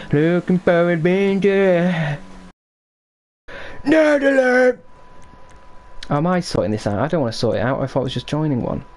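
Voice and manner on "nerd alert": loud callout. Real, not acted. Game stream, calling out.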